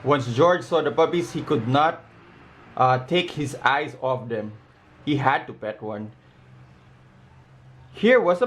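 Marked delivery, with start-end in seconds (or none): none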